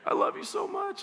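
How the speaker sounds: very high, hammed-up weepy squeak; mock bawling. Husband to wife after a sad movie, mock bawling. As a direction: imitate bawling, voice shooting way up. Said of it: "I'm gonna be bawling".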